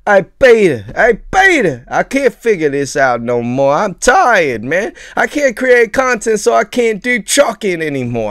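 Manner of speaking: put-on high, loud complaint in a muddled New York-Australian accent; hammed-up quitter with a slipping accent; wandering mock accent. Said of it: "I don't even know where my accent went".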